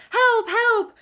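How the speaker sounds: high, loud terrified Piglet squeal; frightened little pig crying for help; panicked cry. A narrator's reading. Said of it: "cried Piglet".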